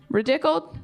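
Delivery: puzzled question. Retold or imitated. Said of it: "They go".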